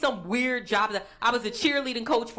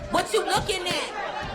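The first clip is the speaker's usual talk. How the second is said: higher, slow, shouted "What you looking at?"; slow shouted taunt; 8 semitones higher, slower than usual. Retold or imitated. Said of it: "They're like".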